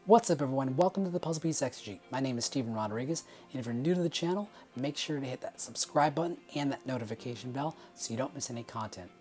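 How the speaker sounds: usual speaking voice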